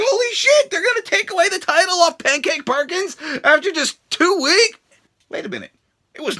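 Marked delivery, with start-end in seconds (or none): 0.0-4.8 much higher mock astonishment; shocked disbelief. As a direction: react like you can't believe it. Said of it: "and I'm like".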